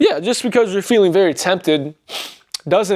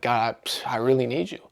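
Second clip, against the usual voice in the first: softer, hushed retold prayer; a quiet, moved plea to God; emotional plea; 4 semitones lower, 8 dB quieter than usual. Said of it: "I got very emotional".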